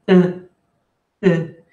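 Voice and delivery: "Eh" said through the nose, like a French nasal vowel; nasal e sound. Shown as a demonstration. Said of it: "hacen el mismo sonido, pero con la nariz". (vocal nasal "eh" francés)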